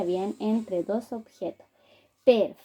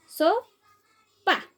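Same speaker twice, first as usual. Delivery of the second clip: SOPA with So higher, a long pause, then pa; segmented syllables; 7 semitones higher than usual. Shown as a demonstration. Teacher leading to the last syllable of sopa, syllables apart. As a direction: leave a gap before the final syllable pa. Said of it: "Última sílaba".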